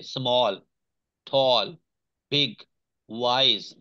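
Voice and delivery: "Small, टॉल, बिग, wise", each word in one single beat; one-syllable demo. Shown as a demonstration. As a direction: say "small, टॉल, बिग, wise" as separate one-piece words with pauses between. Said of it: "एक ही दफा में बोला जाए". ("Small, टॉल, बिग, wise" हर लफ्ज एक ही दफा में, अलग-अलग)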